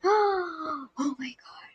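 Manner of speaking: breathless, barely-there whisper after a gasp; gasp, then whisper it slowly in awe; awed whisper. Real, not acted. Streamer overwhelmed by a picture of dessert, awed whisper.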